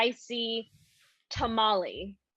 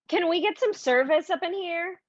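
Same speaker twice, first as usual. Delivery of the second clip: raised, higher voice calling for service; 8 semitones higher, 3 dB louder than usual. Acted. Actress in audition calls for the waiter, raised voice. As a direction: demand service loudly across the room.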